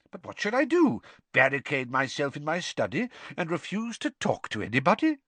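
lightly voiced calm old headmaster; a hint of gentle, wry old wizard; Dumbledore's voice.

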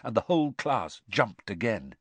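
usual speaking voice

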